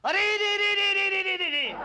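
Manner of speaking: very high, rapid stuttering lament; distressed wail. Acted. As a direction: wail in horror at a great loss. कवि कविताएं कचरे में पाता है. (ऊँचे सुर में विलाप)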